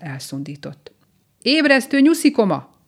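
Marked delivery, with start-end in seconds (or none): 1.4-2.7 high, loud, brassy shout; ringing wake-up call. A narrator's reading. Mole rousing a dozing bunny, ringing shout. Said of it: "harsant fel közelében egy érces hang".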